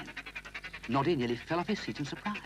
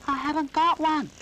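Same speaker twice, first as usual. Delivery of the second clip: much higher and louder, shouted to be heard; Noddy shouting his answer; 7 dB louder than usual. Acted. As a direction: shout it over the wind. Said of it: "You'll have to shout".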